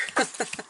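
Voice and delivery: quiet, breathy little laugh; soft chuckle. Real, not acted. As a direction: chuckle softly to yourself. Chuckles at the cards.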